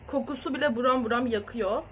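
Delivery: usual speaking voice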